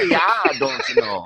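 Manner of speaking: friendly Minnesota local, rising at the end; Minnesota accent. Retold or imitated. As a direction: Minnesota accent, cheerful and mocking. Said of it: "Is this the Netherlands or Minnesota?".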